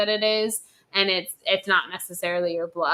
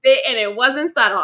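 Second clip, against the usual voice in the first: ordinary delivery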